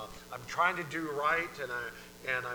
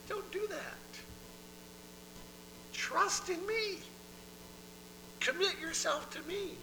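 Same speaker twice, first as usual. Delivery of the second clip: very high, slow coaxing; gently pleading God; 3 dB quieter, slower than usual. Acted. God to someone all worked up, high slow coaxing. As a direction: coax them in a high, drawn-out voice.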